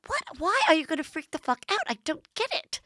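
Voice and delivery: high, put-on baffled voice; high-pitched mock incredulity; Dorit. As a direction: imitate a friend who can't understand the fuss. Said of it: "And Dorit's like".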